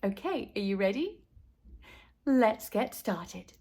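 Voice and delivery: ordinary delivery, nothing marked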